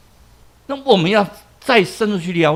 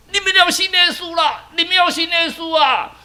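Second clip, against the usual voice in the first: high calling out; 9 semitones higher than usual. Acted. Man keeps preaching, calling.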